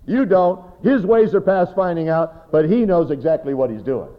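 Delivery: fiery pulpit rebuke; full-force preaching. Real, not acted. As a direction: drive it home to the congregation.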